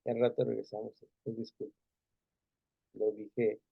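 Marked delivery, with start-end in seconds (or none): 1.2-3.6 quiet, calmed-down apology to his wife; soft apology. Retold or imitated. Husband apologizing softly to his wife after an argument. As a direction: say sorry gently, the anger gone.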